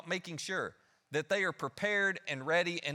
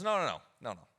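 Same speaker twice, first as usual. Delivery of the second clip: gentle correction; 3 semitones higher than usual. Retold or imitated. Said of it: "Jesus goes".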